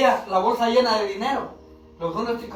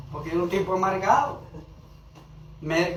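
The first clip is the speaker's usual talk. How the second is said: normal talking voice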